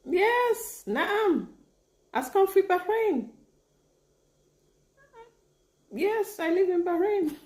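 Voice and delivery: high-pitched, triumphant confirmation; excited. Real, not acted. Learner confirming to a viewer she lives in Bahrain, excited.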